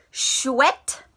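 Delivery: ordinary talk, nothing marked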